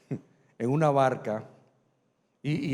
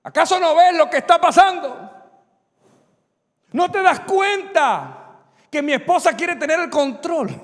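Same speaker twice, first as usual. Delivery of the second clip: loud, high indignant protest; man protesting to God about his wife, loud; complaining to God; 11 semitones higher, 9 dB louder than usual. Acted. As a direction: demand an answer from God. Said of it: "nos acercamos a Dios reclamándole".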